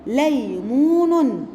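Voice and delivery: ليمون stretched slowly, each syllable drawn out; spelled out ليمون; slow syllable reading. Shown as a demonstration. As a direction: read ليمون very slowly for children. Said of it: "تعالوا نشوف ده في كلمات".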